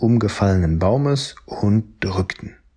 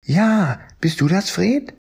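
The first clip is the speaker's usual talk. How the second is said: very high, sweet dinosaur voice; a high little-girl dinosaur asking who is there; purple Stegosaurus; 10 semitones higher than usual. Acted. Lily answers Fred from her cave in a very high voice. Fragte der lilane Stegosaurus.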